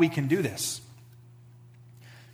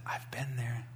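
soft, gentle reassurance, much quieter; gentle kind words to a stranger; reassuring; 3 semitones lower, 7 dB quieter than usual. Retold or imitated. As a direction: comfort a worn-out mother quietly. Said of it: "I said".

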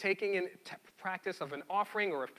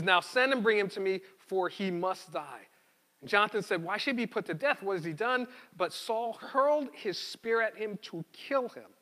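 louder, measured Bible reading voice; scripture reading; 3 semitones higher, 5 dB louder than usual. Acted. Pastor reading Samuel aloud to the congregation, louder read-aloud. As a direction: read the passage out to the church.

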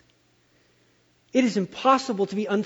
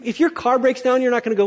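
ordinary delivery

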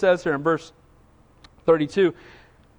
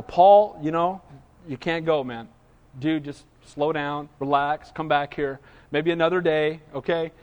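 calming friends; 6 semitones lower than usual. Retold or imitated. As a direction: talk him down gently, voice dropped low. Friends talk Paul down.

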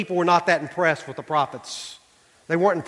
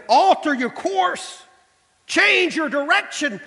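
God's call; 11 semitones higher, slower than usual. Retold or imitated.

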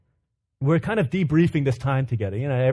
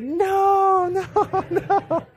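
high, drawn-out imitated wail of refusal; mock toddler shriek, far above your own pitch; retold toddler tantrum; 4 dB louder, much slower than usual. Dad imitating his daughter's night-time tantrum, high mock shouting. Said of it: "She's just going crazy".

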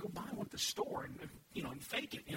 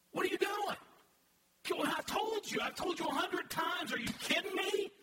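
mock angry yell; 9 semitones higher than usual. Preacher acting out a dad yelling at his kids, mock angry shout. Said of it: "I don't do it that emphatically".

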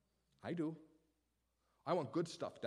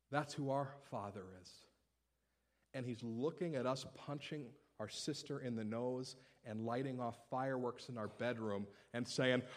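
ordinary talk, nothing marked

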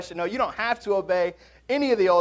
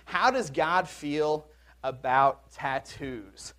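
slow read question; 3 semitones lower, much slower than usual. Acted. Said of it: "filled out on one of the cards".

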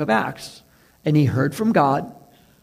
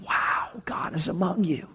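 hushed, breathless wow; awed whisper; 8 dB quieter than usual. Retold or imitated. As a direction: whisper it in wonder. Awed reaction of people.

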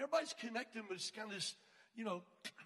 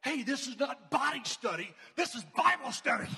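loud, higher scolding outburst; indignant call-out; 4 semitones higher, 9 dB louder than usual. Retold or imitated. Leader wanting to stop the staring couple, indignant shout. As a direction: call them out, punching it loudly. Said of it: "I wanted to stop and go".

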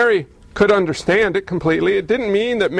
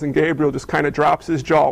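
usual speaking voice

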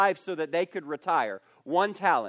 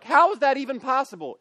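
incredulous; 6 semitones higher, 4 dB louder than usual. Retold or imitated. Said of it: "you're like".

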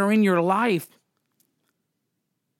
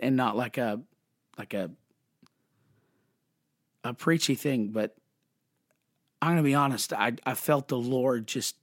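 barely holding it together as feeling rises; quietly moved, words catching; choked up; 6 semitones lower, 4 dB quieter, faster than usual. Real, not acted. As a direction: voice dropping soft, overcome with real emotion. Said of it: "I mean, I, I'm, I'm sorry to, oh man".

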